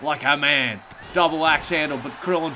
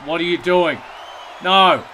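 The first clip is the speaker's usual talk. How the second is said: loud, high desperate yell; shouting; 6 semitones higher, 17 dB louder, much slower than usual. Acted. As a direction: scream no at the ring. Commentator yells at wrestler.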